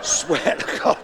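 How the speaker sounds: a breathless, laughing swear to God. Real, not acted. Repeat dissolves into laughter.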